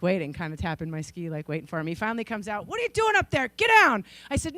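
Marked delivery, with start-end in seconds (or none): 2.7-4.0 high called-out demand; dad calling his daughter down off the hill. Retold or imitated. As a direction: yell it up the hill.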